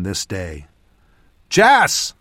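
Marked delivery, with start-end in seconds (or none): 1.5-2.1 high, loud call of a name; shouted. A narrator's reading. Mage calls to Jas mid-battle, shouted. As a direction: shout to get a companion's attention. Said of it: "I shouted".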